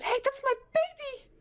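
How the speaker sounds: very high-pitched accusing cry; alarmed real mother. Acted. Real mother points, accuses Jane.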